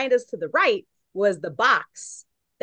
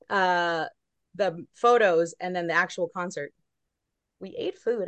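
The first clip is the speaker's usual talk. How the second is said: normal talking voice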